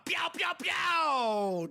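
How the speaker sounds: high, drawn-out "pew" shots; play-shoot with long falling laser zaps; mock gunfire noises. Host celebrates the sniper buyer, playful gunfire noises.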